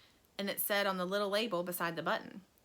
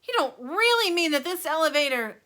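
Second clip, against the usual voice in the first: loud high protest; alarmed shout; 8 semitones higher, 8 dB louder than usual. A narrator's reading. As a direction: shout in disbelief. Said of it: "shouted Grandpa Joe".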